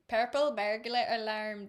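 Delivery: tongue-twister in a Scottish accent. Shown as a demonstration. Reader drifting from Irish, test phrase in Scottish accent. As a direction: roll it out in put-on Scottish. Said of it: "That's Scottish though".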